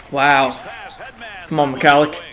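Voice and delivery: ordinary talk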